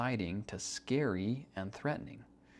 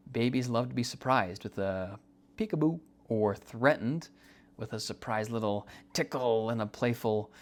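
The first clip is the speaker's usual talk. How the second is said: ordinary delivery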